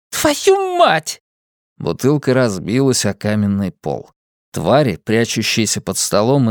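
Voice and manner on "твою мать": shrieked curse. A narrator's reading. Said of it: "выкрикнула она".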